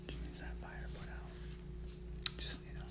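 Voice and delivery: slow, breathy whisper; hushed sultry whisper. Real, not acted. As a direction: whisper it close to the mic. Sultry talk to viewer.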